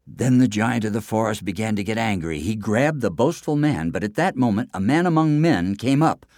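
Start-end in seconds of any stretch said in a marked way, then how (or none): none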